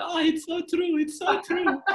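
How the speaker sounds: very high, loud mock wail; hammed-up weepy mother, breaking into laughter; tearful mom impression. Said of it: "she's wiping the tears and she's going".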